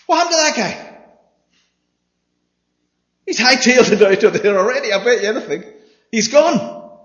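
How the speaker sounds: high, loud amused exclamations; animated exclaiming. Real, not acted. Preacher jokes to congregation about the man who fled, high and loud. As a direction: paint the runaway with glee.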